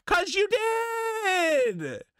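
high drawn-out cry of YOU DID; exasperated yell. Real, not acted. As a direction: stretch it out in exasperation. Shouting the obvious answer.

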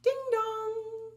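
plain reading voice

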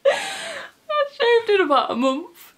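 high squeal of laughter through the words; laughing confession. Real, not acted. Admitting to viewers she hasn't shaved, laughing high.

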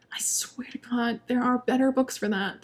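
unvoiced, soft whisper. Real, not acted. Reviewer whispering her complaint to viewers. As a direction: let it slip like a secret.

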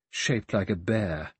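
ordinary delivery

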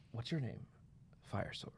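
hushed, understated role-play; mock introduction. Host pretends to be named Fire Sword, hushed mock introduction. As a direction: drop right down as if coolly giving a name. Acts out being asked their name.

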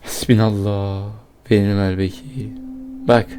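very low, soft mutter; exasperated muttering. Real, not acted. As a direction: mutter it under your breath.

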